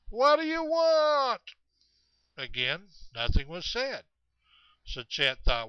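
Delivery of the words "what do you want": slow, loud, high holler; hollering back. Retold or imitated. Chet turning toward the sound, hollering back slowly. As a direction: stretch it out as a far-carrying call. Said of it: "turned towards the direction ... came and go".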